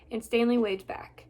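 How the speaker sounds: plain reading voice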